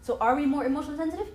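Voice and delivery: usual speaking voice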